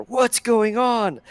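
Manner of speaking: high, slow, drawn-out bewildered question; bewildered old guard. Acted. Hardro asking the conductor, high and slow. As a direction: stretch it out in confusion.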